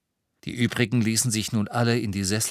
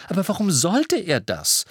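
aufgeregte Frage; 9 semitones higher, 4 dB louder than usual. A narrator's reading. Mrs Weasley fragt aufgeregt.

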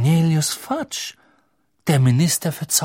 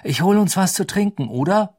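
put-on higher, louder boyish voice; bright eager teen; Ron's voice; 7 semitones higher, 4 dB louder than usual. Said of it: "sagte Ron".

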